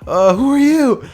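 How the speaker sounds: high, flustered and loud; flustered fright. Acted. Deku asking his rescuer, flustered and high. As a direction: blurt it out, still rattled. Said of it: "he's freaking out".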